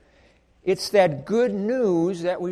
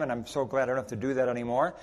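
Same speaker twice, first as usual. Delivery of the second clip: ordinary talk, nothing marked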